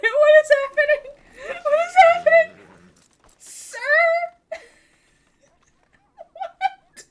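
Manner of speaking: very high, loud, laughing and squealing; laughing disbelief. Real, not acted. Streamer baffled by her horse glitching, laughing high. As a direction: crack up in confused disbelief.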